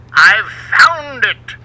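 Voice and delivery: loud, slow, far-off triumphant call; excited exclaim. A narrator's reading. Sailor calling up from the cavern, thrilled and loud. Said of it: "Sailor John exclaimed from the hole".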